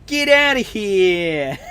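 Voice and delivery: loud, slow, hammed-up New York "Get outta here!"; mock New York accent. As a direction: stretch it out like a brash New Yorker. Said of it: "I can't do a New York accent".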